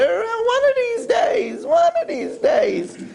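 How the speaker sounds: very high, slow and stretched; mock wishful promise. Married person putting off romance, mock wishful. Voices a procrastinating spouse.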